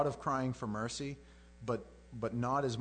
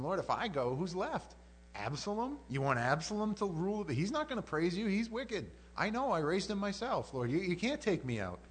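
higher, acted protest to God; dramatized bargaining, pitch raised; pleading argument; 6 semitones higher than usual. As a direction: reason urgently with the Lord. David arguing with God to spare him.

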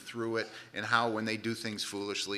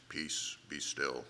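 authoritative; 9 semitones lower, 7 dB quieter, much slower than usual. Shown as a demonstration. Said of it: "That sounds pretty authoritative".

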